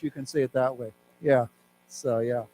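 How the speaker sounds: normal talking voice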